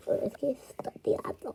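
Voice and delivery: slow whisper; hushed start. Real, not acted. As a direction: whisper it slowly.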